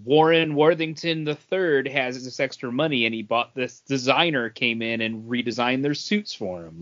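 ordinary talk

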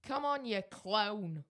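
loud, slow, broad Bolton "clone" for clown; Bolton accent. Shown as a demonstration. Co-host correcting host, loud Bolton accent. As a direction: belt out the Bolton phrase slowly. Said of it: "I'm very good at his accent".

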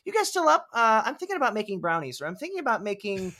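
sing-song friendly offer at two a.m; lightly hammed-up eccentric hostess; imitating Catherine. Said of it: "just be like".

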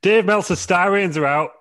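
loud, slow, high announcement; announcer voice. Acted. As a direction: announce it like big news. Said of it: "new segment of the weekly news roundup".

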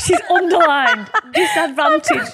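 high, loud laughing mockery; laughing tease. Real, not acted. Teasing co-host over underlined notes.

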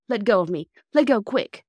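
urgent plea. A narrator's reading. Injured boy begs to be released.

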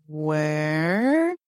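low, drawn-out rising question; mild retold dread; wary question. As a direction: ask it warily, stretching the word. Warily asks about the face.

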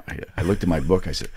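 ordinary talk, nothing marked

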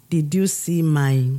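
ordinary talk, nothing marked

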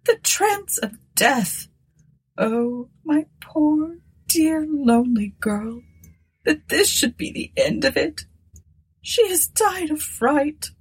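put-on high, grief-stricken old woman; sobbing bitterly. A narrator's reading. Old woman kneeling by the deathbed, sobbing bitterly. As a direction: lament in a high voice, drawn out at first. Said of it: "sobbing bitterly".

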